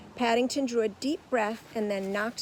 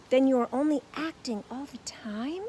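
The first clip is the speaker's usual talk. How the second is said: faltering; 5 semitones higher, 6 dB louder than usual. A narrator's reading. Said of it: "he faltered".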